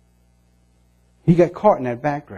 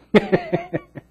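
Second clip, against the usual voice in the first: high amused laugh. Real, not acted. Laugh after mock retort.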